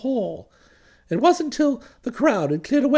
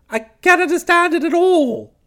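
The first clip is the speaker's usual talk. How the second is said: high, louder baffled insistence; bewildered protest; 5 semitones higher, 4 dB louder than usual. Acted. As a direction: insist you are utterly mystified. Bertie repeats his bewilderment.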